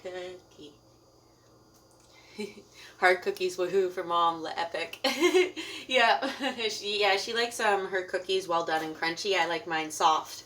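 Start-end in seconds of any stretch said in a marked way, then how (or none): 5.0-5.8 laugh. Real, not acted. Laughs about the cookies.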